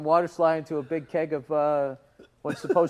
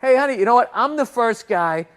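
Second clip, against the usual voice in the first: imagined sarcastic retort; 4 semitones higher, 6 dB louder than usual. Pretend. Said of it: "I wanted to be like".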